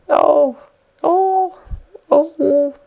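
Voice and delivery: high, loud wailing oh; pitiful moaning. Acted. Trapped puppet wails his regret, high and loud. Pinocchio laments his fate.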